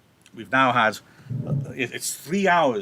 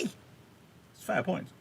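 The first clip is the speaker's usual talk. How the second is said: usual speaking voice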